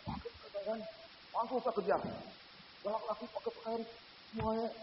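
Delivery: ordinary delivery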